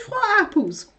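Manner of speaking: high baffled question; incredulous. Retold or imitated. Asking partner about imaginary apples, high baffled. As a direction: pitch it up in disbelief.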